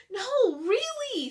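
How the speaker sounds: high, loud pleading; insistent. Acted. Annie insists to Jack that the monster is real. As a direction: insist it's true.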